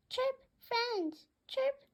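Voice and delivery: very high chirpy budgie call; bird chirp. Acted. Budgie greeting other birds at the shop, very high chirp.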